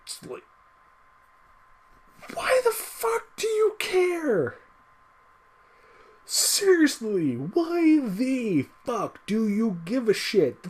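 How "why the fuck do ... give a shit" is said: high, slow, incredulous and close to tears; exasperated disbelief. Real, not acted. Ranting about fans angry over Starfire, high exasperated disbelief. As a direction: drag out each word in disbelief.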